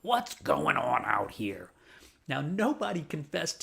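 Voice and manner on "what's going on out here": lower, slow gruff demand; a hint of an angry neighbor lady storming out; mild mock scolding shout; yelling. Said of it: "She starts yelling".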